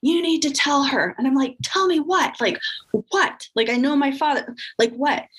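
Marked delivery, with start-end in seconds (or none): 0.0-1.2 retold yell. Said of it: "yelling in, in the background". 1.6-5.2 a high, startled, disbelieving question; alarmed confusion. Retold or imitated. Alarmed reply to aunt's hint.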